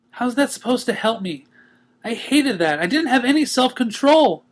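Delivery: high frustrated pony voice; upset and rueful; Twilight voice. Acted. Twilight answers Pinkie.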